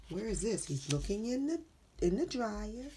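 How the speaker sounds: plain reading voice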